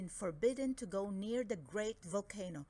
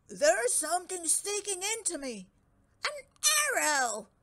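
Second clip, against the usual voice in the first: loud, higher surprised cry; startled giant; 8 semitones higher, 7 dB louder than usual. Acted. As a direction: shout out in shock. Redbeard feels arrow.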